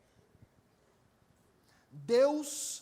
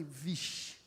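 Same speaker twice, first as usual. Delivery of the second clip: dismayed muttered vixe; 8 semitones lower, 9 dB quieter than usual. Retold or imitated. Preacher's dismay.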